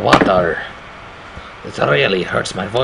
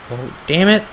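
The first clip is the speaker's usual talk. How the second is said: ordinary talk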